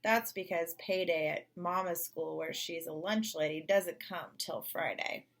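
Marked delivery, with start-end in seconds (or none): none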